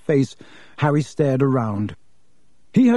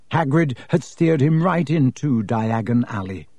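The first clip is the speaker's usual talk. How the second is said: ordinary delivery, nothing marked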